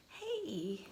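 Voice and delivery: ordinary delivery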